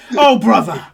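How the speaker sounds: loud, grandiose showman's promise; rousing big-hearted pitch; exuberant dock worker. Acted. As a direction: proclaim it with theatrical gusto. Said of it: "He goes".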